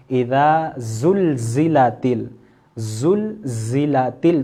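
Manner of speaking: زلزلت with a long hissing ز, very slow; whistling z demo. Shown as a demonstration. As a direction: hiss the ز in زلزلت slowly. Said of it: "سیٹی کی آواز محسوس ہو رہی ہے". (زلزلت میں ز کی سیٹی دار آواز)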